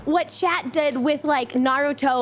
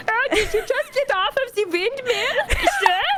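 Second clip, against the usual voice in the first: high, laughing mock-posh voice; mock snooty lady; 9 semitones higher than usual. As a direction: tease the traveller from the windmill, laughing. Mocking a Dutch visitor.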